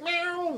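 high, soft little meow; a light cat imitation mid-story; imitated cat meow. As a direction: mew like a sweet kitten.

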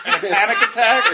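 high, squeaking with laughter; laughing burst. Real, not acted. Host describing a panic attack, cracking up. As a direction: break into laughter on the last words.

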